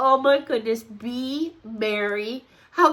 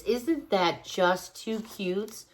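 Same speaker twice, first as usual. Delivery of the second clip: usual speaking voice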